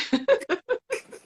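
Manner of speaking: high delighted burst of laughter. Real, not acted. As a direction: laugh out at the joke. Laughing at the grandbaby news.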